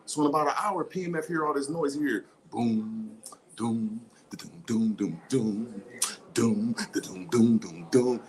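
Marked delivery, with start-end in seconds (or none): 2.0-8.2 low slow boom doom beat; beatbox the bass thumping; bass thump imitation.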